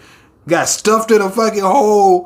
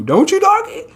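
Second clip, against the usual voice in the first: high pleading question. Acted.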